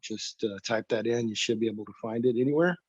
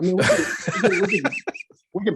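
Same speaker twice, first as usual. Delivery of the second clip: laughing through the words, quick and higher; 6 semitones higher, 4 dB louder, much faster than usual. Real, not acted. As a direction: chuckle while rushing it out. Joking about piling on.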